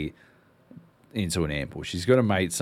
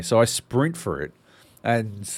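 ordinary talk

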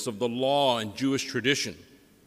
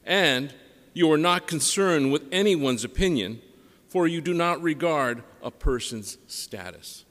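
solemn, measured liturgical reading; Gospel reading; 3 semitones lower than usual. Acted.

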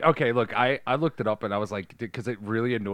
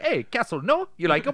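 high-pitched, exaggerated Spanish accent; mock Spanish accent; 11 semitones higher, 4 dB louder than usual. Imitating an annoying Spanish actor in the movie, mock Spanish accent. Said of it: "a terrible accent".

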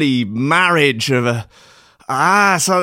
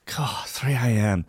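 much softer, half-asleep groan; groggy mutter; 2 semitones lower, 7 dB quieter than usual. Acted. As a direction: mumble it like you've just woken at three. Groggy reaction to the clock.

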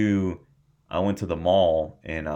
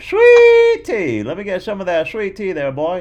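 much higher, louder hammed-up Southern accent; 14 semitones higher, 13 dB louder than usual. Coworker from Georgia asking for sweet tea, in a loud, high mock Southern accent. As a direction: ham up a Southern good old boy begging for sweet tea. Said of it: "he was Southern".

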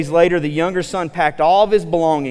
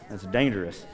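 ordinary delivery

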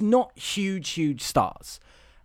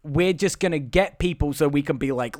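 usual speaking voice

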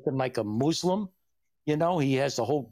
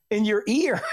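normal talking voice

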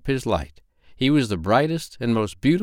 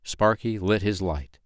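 ordinary delivery, nothing marked

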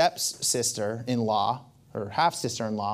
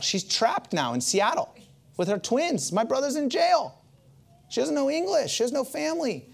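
much higher, pressing how alone she is; emphatic; 12 semitones higher than usual. Real, not acted. Telling the church how alone she was, voice raised high. Stressing her lonely plight.

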